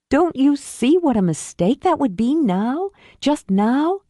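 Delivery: worried urging; urgent sister. Acted. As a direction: make her see the mistake. Jamie pleads with sister.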